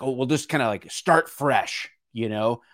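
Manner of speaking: hammed-up politician announcing a fresh start; playing a weary lawmaker waving everyone through; mock politicians. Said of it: "I think maybe they're like".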